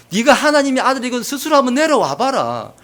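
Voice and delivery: high, loud jeering challenge; acted derision; mock taunting. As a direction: goad him as a scornful onlooker would. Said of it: "비아냥된다는 것입니다".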